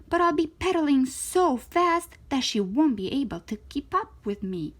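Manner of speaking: usual speaking voice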